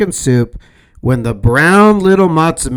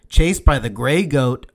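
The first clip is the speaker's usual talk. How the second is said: usual speaking voice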